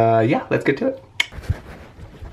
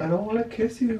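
higher, tearful crying voice; 7 semitones higher, 6 dB quieter than usual. Acted. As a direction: pretend to cry, voice wavering. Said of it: "Why are you crying?".

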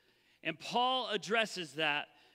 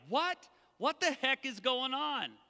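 high, rising stunned what; Paul's astonished outburst; what the heck is going on, shocked and high; shocked disbelief; 6 semitones higher than usual. Shown as a demonstration. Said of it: "This is like".

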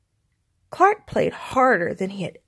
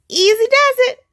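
self-coaching; 8 dB louder than usual. Acted. Clark calming himself down.

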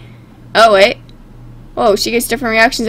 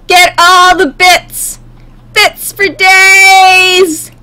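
high, loud, stretched-out cartoon yell; hammed-up shout; 6 semitones higher, 5 dB louder, much slower than usual. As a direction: holler it slowly, holding the last word. Acts gleeful robber shouting.

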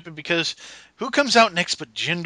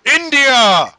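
louder, higher, hammed-up proclamation; mock announcer shout; 8 semitones higher, 10 dB louder than usual. Mocking the wrestler's entrance with a loud hammed-up shout. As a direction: belt it out like a ring announcer.

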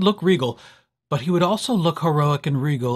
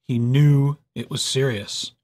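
usual speaking voice

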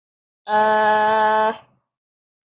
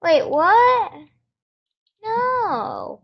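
surprised; 8 semitones higher, slower than usual. Real, not acted. Friend left the game.

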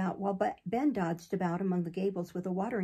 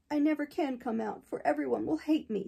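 sobbing; 6 semitones higher than usual. A narrator's reading. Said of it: "sobbed Bab among the straw".

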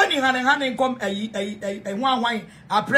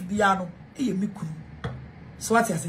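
normal talking voice

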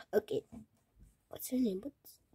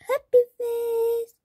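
higher, slow, beaming happy-face voice; mock happy; 10 semitones higher, 3 dB louder than usual. Shown as a demonstration. Girl unboxing toys shows a happy face, mock cheerful. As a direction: say it like a big grin.

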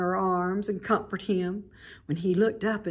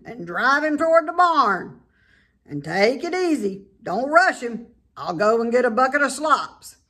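calling out; 5 semitones higher, 5 dB louder than usual. A narrator's reading. Farmer giving orders.

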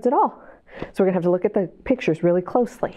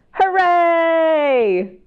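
cheering hooray; 7 semitones higher than usual. Acted. Turtle helps, reader cheers.

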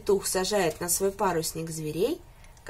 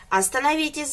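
higher, calling out to stop them; rooster shouting; 6 semitones higher, 4 dB louder than usual. A narrator's reading. Rooster calls to the animals rushing aboard, shouting. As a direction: shout a warning across the pier. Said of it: "закричал петух".

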